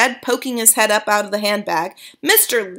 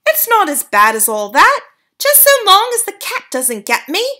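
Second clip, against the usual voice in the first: put-on much higher, louder squeaky-kid voice; boy turned mouse popping out of the handbag; cheeky mouse-boy; 10 semitones higher, 7 dB louder than usual. A narrator's reading. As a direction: chirp it cheekily. Bruno speaks.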